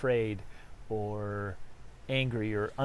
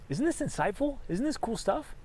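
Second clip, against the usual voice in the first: delighted rhetorical question; 10 semitones higher than usual. Real, not acted.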